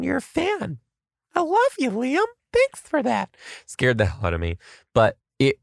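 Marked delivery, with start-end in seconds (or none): 0.0-3.4 very high, squeaky cutesy voice; playful falsetto thank-you; mock gushing. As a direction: coo it in falsetto to a fan. Mock gushing to scary parent.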